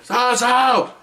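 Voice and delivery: high mock yell of a name; imitated yell. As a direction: call out like an outraged boss, imitated. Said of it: "he yells my name".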